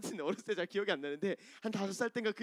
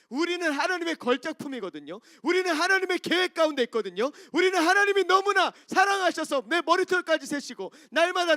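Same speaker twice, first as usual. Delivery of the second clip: full-force preaching; 9 semitones higher, 11 dB louder than usual. Acted. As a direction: proclaim it like a fervent preacher. Preaching climax.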